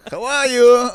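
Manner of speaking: high, slow, loud Yiddish rabbi voice; Yiddish accent. Acted. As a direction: play a wise old rabbi named Shlomo. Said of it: "Hello. my name is Shlomo".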